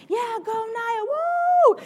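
high excited cheer; cheering friends. Retold or imitated. Friends cheering her on, loud and high. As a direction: whoop like friends at the roadside. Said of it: "and they're like".

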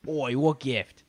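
mock English accent, puzzled. [name] on the phone about the gift, English accent. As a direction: ask it in a put-on English accent. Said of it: "is a, uh, English story".

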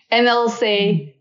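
ordinary talk

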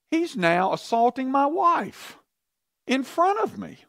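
outraged king. Retold or imitated. King seeing Haman at queen's couch, high outraged disbelief. King's outraged thought voiced.

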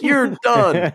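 high hammed-up verdict; mock dismissal. Owner tells the dog he's finished, high mock voice. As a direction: cut him off in a high silly voice. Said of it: "He goes".

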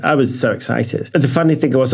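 usual speaking voice

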